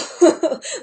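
sudden high loud laugh; burst of laughter. Real, not acted. Recalling ripping the strobe light from the wall, laughing. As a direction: laugh out at the memory.

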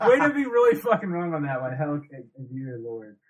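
ordinary delivery

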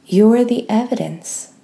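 normal talking voice

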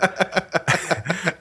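a high, rising burst of laughter at himself; real laugh. Real, not acted. Laughing at his own bombed gig.

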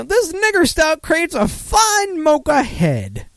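high, slow put-on announcer voice; mock ad read. Reading a viewer's joke beer description in a mock ad voice. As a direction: ham up a fancy beer blurb.

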